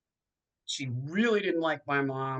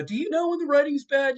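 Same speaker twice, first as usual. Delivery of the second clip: higher and louder, eager fan asking; mock earnest fan question; 13 semitones higher, 6 dB louder, faster than usual. A fan quizzing the actress about the writing, eager and high. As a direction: ask it like a curious fan cornering an actor. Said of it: "And people go".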